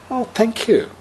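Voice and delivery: a light imitation of a touched old woman; grateful old woman. Old woman thanks the lama.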